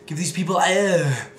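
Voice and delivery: loud, slow Arnold-style bark; Arnold impression. Arnold impression commanding a crowd loudly. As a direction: boom it out like Schwarzenegger.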